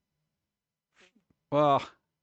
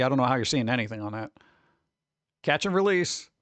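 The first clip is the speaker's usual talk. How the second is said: normal talking voice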